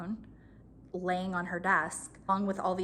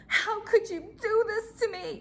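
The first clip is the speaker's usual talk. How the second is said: much higher, whining boss impression; whiny voice; 13 semitones higher, 3 dB louder than usual. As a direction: whine it in her fake-hurt voice. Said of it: "that same voice she was, like, using with her husband".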